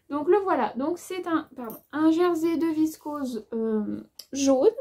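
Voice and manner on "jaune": "jaune" said the standard French way, not in her accent; careful standard pronunciation. Shown as a demonstration. Vlogger avoiding her own accent on the fabric colour, careful standard "jaune". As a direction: say "jaune" carefully, with a closed o, louder. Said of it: "pour le dire bien que tout le monde".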